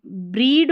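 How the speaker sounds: ब्रीड with a long ee; wrong trial reading. Shown as a demonstration. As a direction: stretch the ee in ब्रीड. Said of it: "तो ये ब्रेड है". (गलत विकल्प: ब्रीड, लंबी ई के साथ)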